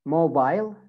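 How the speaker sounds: British "mobile", ending like "bile"; British accent. Shown as a demonstration. Teacher models British "mobile" for students, long I. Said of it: "In British accent".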